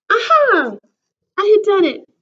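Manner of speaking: triumphant, bright; excited. A narrator's reading. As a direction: burst out with pride. Triumph in story.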